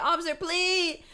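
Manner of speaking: high desperate pleading; mock-beg the officer, voice climbing; begging. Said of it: "I'm not out here pleading with the officer".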